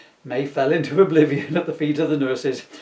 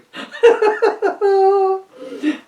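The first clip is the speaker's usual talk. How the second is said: high giggling fit, can't stop; a high squealing laugh breaking through; helpless high laugh; 7 dB louder than usual. Real, not acted. Reading a silly story to viewers, laughing helplessly.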